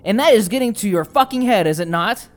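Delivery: slow, loud, high, forceful scolding; commanding angry shout, drawn-out key words; furious dressing-down. Acted. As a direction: hammer each phrase at a wavering ally. Emerald berates Pate face to face.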